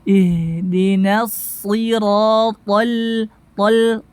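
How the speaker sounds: slow recited IHDINA SIRO, TOL ending lightly; heavy TO, then a thin L in TOL; thin lam. Shown as a demonstration. Teacher leading practice, slow recitation with thin lam. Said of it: "lamnya tipis".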